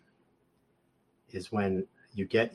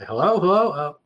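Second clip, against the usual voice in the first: rising, louder calls of hello into the line; calling hello; 10 semitones higher, 9 dB louder than usual. Retold or imitated. Caller on hold calls hello into phone, louder and rising. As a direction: call out hello as if the line went dead.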